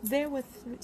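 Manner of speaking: normal talking voice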